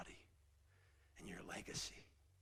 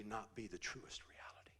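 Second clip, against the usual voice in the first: whisper; 8 dB quieter than usual. Real, not acted. Hushed preaching climax.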